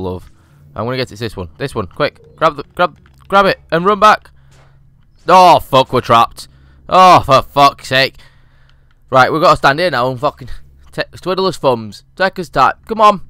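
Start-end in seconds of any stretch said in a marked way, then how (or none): 5.3-8.2 slow, louder groan of frustration; slow, exasperated curse. Real, not acted. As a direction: drag it out, fed up. Trapped in the room.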